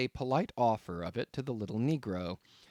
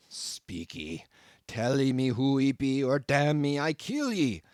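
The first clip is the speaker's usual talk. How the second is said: louder, strange-voiced growling pidgin; growled threat; 2 semitones higher, 4 dB louder than usual. A narrator's reading. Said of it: "again growled the cannibal".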